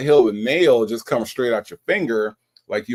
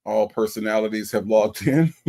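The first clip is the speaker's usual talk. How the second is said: ordinary talk, nothing marked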